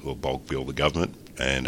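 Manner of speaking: normal talking voice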